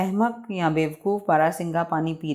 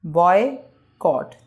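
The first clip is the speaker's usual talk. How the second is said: put-on boycott slowly split as boy, pause, cot; two separate beats on boycott; broken into syllables; much slower than usual. Shown as a demonstration. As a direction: leave a gap inside boycott. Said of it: "दो parts में हम अदायगी करते हैं".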